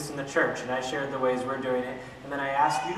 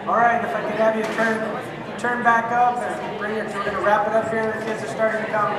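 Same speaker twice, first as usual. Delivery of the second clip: louder, higher call over the room; 6 semitones higher, 5 dB louder than usual. Real, not acted. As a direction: raise the voice to pull the groups back. Calling the room back together.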